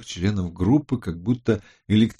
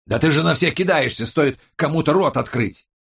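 raised, heated complaint; angry accusation; 6 semitones higher, 3 dB louder than usual. A narrator's reading. Colleague accuses her of attacking everyone, heated. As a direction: hit back heatedly at an attacker.

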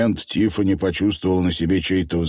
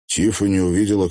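plain reading voice

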